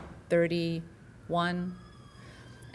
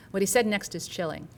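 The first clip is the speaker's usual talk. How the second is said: usual speaking voice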